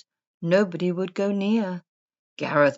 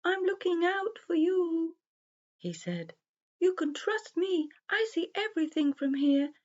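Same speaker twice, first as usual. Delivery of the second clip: a gentle owl reassuring the animals; wise old owl; 9 semitones higher than usual. Acted. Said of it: "He blinked his big round eyes and said".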